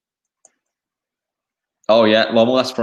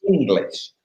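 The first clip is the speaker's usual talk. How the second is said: raised pitch, emphatic retort; retold insistence, pitched up; mock exasperated reply; 7 semitones higher than usual. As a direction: spell it out as if to someone not getting it. Retelling exasperated reply to teammate.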